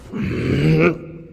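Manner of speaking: rough throat noise as a demo; throaty noise, demonstrating concentration; demonstrated navel-focus noise. Shown as a demonstration. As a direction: make a short straining sound in the throat. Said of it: "you concentrate in your navel, and you say".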